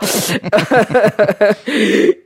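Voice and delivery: long real chuckle; laughing. Real, not acted. Presenter amused by own slangy praise, laughing. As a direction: break into a drawn-out laugh.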